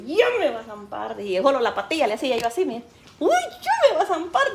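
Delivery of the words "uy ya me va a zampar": much higher, worried; high scared child voice; high fearful quote. Retold or imitated. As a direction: squeak it like a scared kid.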